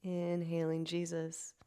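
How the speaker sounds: soft, slow guided prayer; slow breath prayer. Shown as a demonstration. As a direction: breathe the words out slowly. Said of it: "five more breath cycles".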